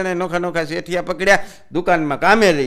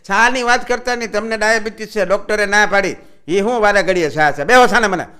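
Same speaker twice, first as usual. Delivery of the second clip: loud, higher scolding yell; loud cheeky shout at the boss; 5 semitones higher, 6 dB louder than usual. Acted. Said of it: "આણે અંદરથી રાડ પાડી".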